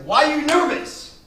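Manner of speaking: high, very loud drawn-out demand; hammed-up dad yell. Dad confronting his nervous son before the match, hammed-up yell. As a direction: yell it slowly as an exaggerated father.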